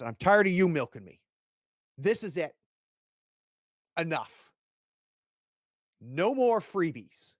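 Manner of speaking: father ordering his son home, angrily; stern anger. Acted. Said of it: "and he goes".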